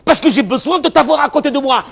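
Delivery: high-pitched, full-force shouted plea; impassioned shout. Acted. Acted outcry, shouted full force. (cri passionné, aigu et très fort)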